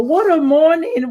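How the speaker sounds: usual speaking voice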